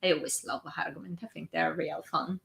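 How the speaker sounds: ordinary delivery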